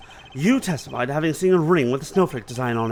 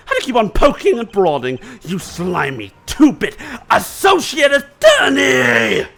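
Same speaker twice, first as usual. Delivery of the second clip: loud, slow, higher snarled insult; angry shout; 7 semitones higher, 6 dB louder, slower than usual. Acted. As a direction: spit the insult at the attorney. Witness snaps at lawyer.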